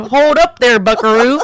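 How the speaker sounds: put-on laughing Texas cowboy accent. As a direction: play a laughing Texan cowboy. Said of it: "Did you just do a Texas accent".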